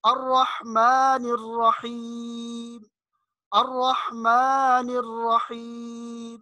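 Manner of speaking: very slow sustained chant, pharyngeal ح in الرحمن الرحیم; correct deep ha. Shown as a demonstration. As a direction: stretch الرحمن الرحیم, ha from mid-throat. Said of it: "اس مخرج سے اس کو نکالنا ہے". (الرحمن الرحیم کھینچ کر، حلق والی حا)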